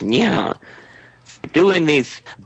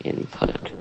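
whispered, to yourself; muttering to self; 13 dB quieter than usual. Real, not acted. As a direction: mutter while fiddling with settings. Adjusting audio settings.

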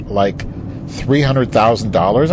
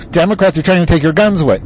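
high, hurried alarmist voice; whiny parody of a scaremonger; mock Republican; 7 semitones higher, 3 dB louder, faster than usual. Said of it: "The Republicans are saying".

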